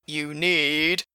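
teasing whine; mock whine. A narrator's reading. Bob mocking Frank's whine back at him. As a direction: mimic the whining back. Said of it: "Bob mock whined back".

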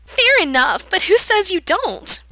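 put-on high, manic girl's voice with a hysterical edge; eerie girl mocking a stranger, high and manic. A narrator's reading. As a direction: play a giggly, unhinged girl teasing him. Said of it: "it's high-pitched, with an edge to it that might be hysteria".

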